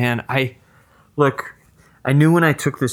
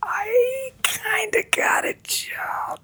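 whispered, slow and guilty; sheepish whisper; 7 dB quieter, much slower than usual. Acted. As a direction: breathe the admission out slowly. Sheepish admission after "you got a what.

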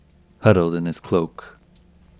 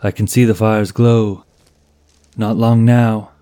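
yelling; 4 semitones higher, 6 dB louder than usual. A narrator's reading. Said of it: "He had to yell to be heard".

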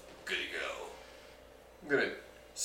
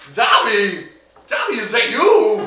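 calling out loud; 10 semitones higher, 15 dB louder than usual. Acted. Calling out to Dobby below.

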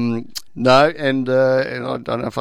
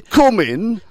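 imitating a Welsh homeowner in a higher Welsh accent; welcoming Welsh accent, pitched higher and inviting; 11 semitones higher, 6 dB louder than usual. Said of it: "in his Welsh accent".

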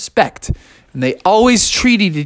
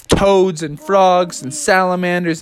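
ordinary delivery, nothing marked

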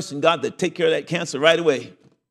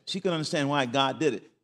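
normal talking voice

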